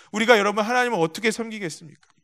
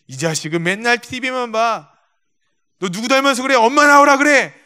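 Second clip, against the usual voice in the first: building from grumble to a loud, high shout; furious father; 4 semitones higher, 6 dB louder than usual. Retold or imitated. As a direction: blow up at the son and call for mom. Angry drunk father yells at son.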